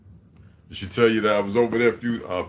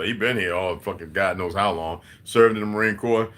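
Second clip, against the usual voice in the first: ordinary talk, nothing marked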